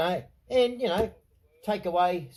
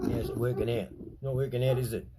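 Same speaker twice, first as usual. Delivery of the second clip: "How's it working out?" repeated lower and softer, sarcastic; sarcastic muttered repeat; low sarcastic taunt; 8 semitones lower, 3 dB quieter, faster than usual. Shown as a demonstration. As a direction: drop the voice and sneer the question. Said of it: "repeated lower as taunt".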